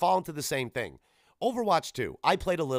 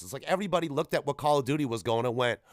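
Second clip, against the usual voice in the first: usual speaking voice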